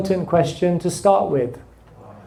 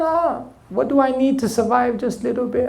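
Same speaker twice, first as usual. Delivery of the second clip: lazy boatman; 5 semitones higher, 3 dB quieter, faster than usual. Retold or imitated. Lazy boatman answering the businessman.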